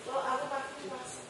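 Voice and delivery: soft, unsure customer voice, well below normal level; timid mumble. Retold or imitated. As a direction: mumble it like a shy seller with no confidence. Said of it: "or you're saying".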